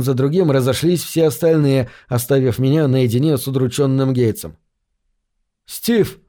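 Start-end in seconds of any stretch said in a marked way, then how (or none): 5.7-6.2 frightened. Acted. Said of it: "настороженно и испуганно".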